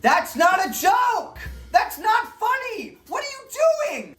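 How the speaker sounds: yelled high and loud, slowed on each word; exasperated screaming rant at a bad comedian; furious shout. Real, not acted. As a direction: blow up at the screen in outrage. Ranting at a creator, yelling.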